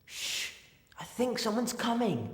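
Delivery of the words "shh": soft unvoiced shh; hushing shh. Hiding from someone coming, quietly hushing friends. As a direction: hush your friends urgently.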